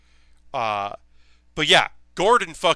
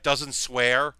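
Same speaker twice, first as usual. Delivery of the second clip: usual speaking voice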